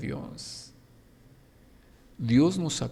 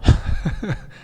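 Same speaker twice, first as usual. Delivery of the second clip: quick breathy burst of laughter; short laugh; 7 semitones higher, 7 dB louder than usual. Real, not acted. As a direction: laugh out loud at the idea. Laughs at mud image. (risa breve y aireada)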